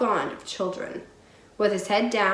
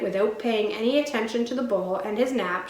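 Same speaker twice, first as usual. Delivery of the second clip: ordinary delivery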